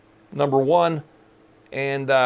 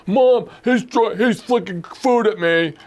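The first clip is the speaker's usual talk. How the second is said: slow, drawn-out mock complaint to Mom; tattling kid; 4 semitones higher, slower than usual. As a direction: imitate a child telling on a sibling. Imitates sibling tattling to mom.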